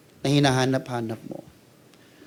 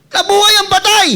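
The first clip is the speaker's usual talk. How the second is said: amazed cry; 9 dB louder than usual. Retold or imitated. Crowd crying dead man lives.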